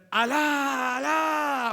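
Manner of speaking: long, high, loud drawn-out cry; imitated shouting prayer. Preacher mimicking how they cry out in prayer, loud drawn-out shout. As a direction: wail it out at full voice, mimicking distant worshippers. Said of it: "Ellos gritan".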